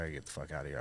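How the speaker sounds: low, soft retold mutter; muttered aside. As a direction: mutter it under your breath. Retold stressed inner remark.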